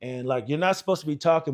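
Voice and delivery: usual speaking voice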